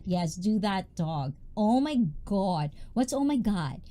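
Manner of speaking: ordinary talk, nothing marked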